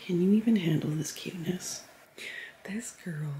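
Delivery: cooing whisper to a pet. Real, not acted. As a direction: whisper it, adoring. Cooing over the dog.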